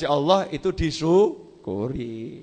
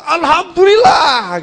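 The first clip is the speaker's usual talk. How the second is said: Alhamdulillah bellowed much higher and louder, slow and drawn out; tactless loud shout; 11 semitones higher, 13 dB louder, slower than usual. Shown as a demonstration. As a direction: shout Alhamdulillah right over the fallen person. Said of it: "Jangan ada orang delosor depan kita". (Alhamdulillah diteriakkan keras, tinggi dan panjang di depan korban)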